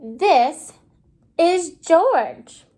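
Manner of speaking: ordinary talk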